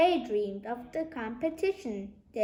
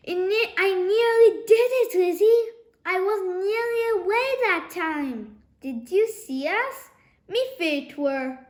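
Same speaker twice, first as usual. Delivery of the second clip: giggling through pain; 6 semitones higher, 6 dB louder than usual. A narrator's reading. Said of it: "He giggled through his pain".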